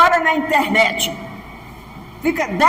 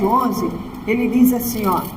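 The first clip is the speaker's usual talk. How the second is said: ordinary talk, nothing marked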